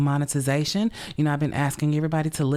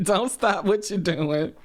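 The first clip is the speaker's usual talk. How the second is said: slow laughing plea; 3 semitones higher than usual. Real, not acted. Still laughing, encouraging listeners.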